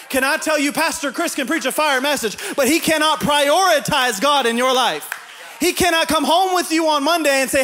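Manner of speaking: high, loud, driving sermon climax; preaching at full force. Acted. Pastor urging the congregation to put God first, shouting. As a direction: preach it up to the rafters.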